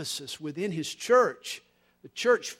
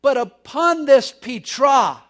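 slow, loud and higher, declaring it; slow ringing preacher's shout; booming proclamation; 5 semitones higher, 12 dB louder, slower than usual. Retold or imitated. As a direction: proclaim it like Jesus pointing at the cliff. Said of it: "And he says".

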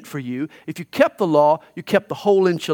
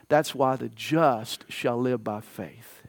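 soft, slow; 8 semitones lower, 8 dB quieter, slower than usual. Shown as a demonstration. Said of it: "each time the emphasis is on a different word".

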